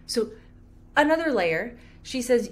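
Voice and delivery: usual speaking voice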